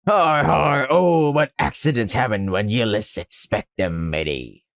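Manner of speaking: hammed-up low pirate villain. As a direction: laugh mockingly, then menace like a pirate. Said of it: "So he is a pirate".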